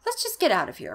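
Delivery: high-pitched urgent plea; exclaimed. A narrator's reading. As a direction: urge everyone out in mild alarm. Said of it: "Margaret exclaimed".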